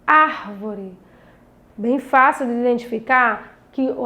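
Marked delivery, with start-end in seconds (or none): 0.1-1.0 "Árvore" stretched on the first syllable, falling after; deliberate ÁR-vore; stress on ÁR. Shown as a demonstration. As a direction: hold ár, then drop lower on vore in "Árvore". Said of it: "eu pronunciei com mais intensidade".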